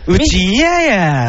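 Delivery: high, drawn-out mock Kansai whine. Co-host imitates [name]'s dubious Kansai dialect, mock Kansai accent. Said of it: "みその的な".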